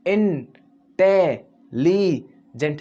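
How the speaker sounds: इंटेलिजेंट very slowly as इन-टे-ली-जेंट; four drawn-out beats in इंटेलिजेंट, gaps between; broken into syllables. Shown as a demonstration. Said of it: "चार बार में आवाज़ निकल रही है".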